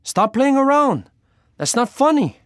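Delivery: very high, loud frightened protest; alarmed, high-pitched shouting; scream. Acted. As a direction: scream at him to quit it. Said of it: "I screamed".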